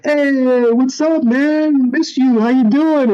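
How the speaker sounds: imitating a drawn-out, very high happy greeting; delighted dad. Dad greeting his son at the garage, delighted. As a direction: sing out an overjoyed dad's hello, high-pitched. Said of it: "he loves it when I come home".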